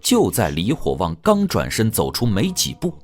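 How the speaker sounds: ordinary talk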